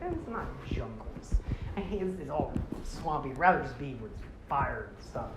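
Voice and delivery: quiet, half-hearted griping; grumbling. Retold or imitated. Takua gripes about swampy jungles, low-key grumble. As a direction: grumble softly as the character.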